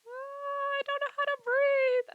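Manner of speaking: very high, loud, slow mock wail; drawn-out, high-pitched fake cry; imitated crying. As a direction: wail it like a sobbing client, hammed-up. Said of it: "the client starts crying".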